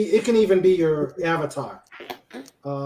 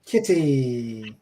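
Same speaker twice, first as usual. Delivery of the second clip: ordinary talk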